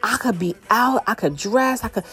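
imagined newfound freedom, lightly acted; relieved. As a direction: let it out like a sigh of relief. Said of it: "like a sigh of relief".

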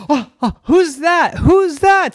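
high, loud alarmed cry; startled. Acted. Girl in the cave hearing a voice, startled high cry. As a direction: jump at the strange voice.

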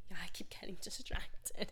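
an embarrassed whispered admission to mom; sheepish whisper. Retold or imitated. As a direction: whisper the excuse, half laughing. Retold reply to mom.

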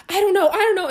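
retold helpless fluster. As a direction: panicky repeated protest. Retold flustered mother answering.

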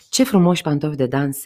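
loud exclaim. A narrator's reading. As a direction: call it out with loud admiration. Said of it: "exclamă soldatul". (exclamație tare, încântată)

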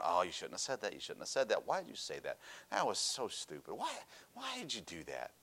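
hushed, muttered self-reproach under the breath; inner self-scolding. Retold or imitated. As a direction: scold yourself quietly, as if in your own head. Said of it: "sitting there thinking in myself".

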